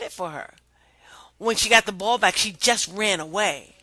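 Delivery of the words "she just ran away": ordinary delivery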